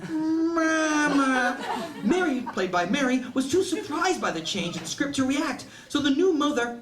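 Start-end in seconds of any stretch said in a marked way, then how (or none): 0.1-1.6 high, mechanical toy-doll "Mama"; sing-song talking-doll "Mama", high and flat; doll voice box. Retold or imitated. Broken baby doll voice box calls out, high and mechanical. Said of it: "the baby said".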